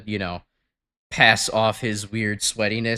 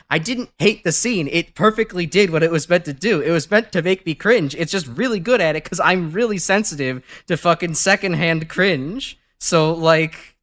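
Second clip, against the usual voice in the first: higher-pitched, mock-indignant self-defense; exasperated protest; 7 semitones higher, 4 dB louder than usual. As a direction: plead your case in a raised pitch. Retelling his protest to friend's teasing.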